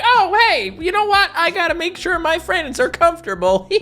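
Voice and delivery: high, sweet cartoon dog voice of pure goodwill; goofy dog voice. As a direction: squeak it out like a dopey, kind cartoon dog. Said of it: "he's like".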